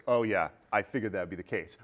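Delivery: ordinary talk, nothing marked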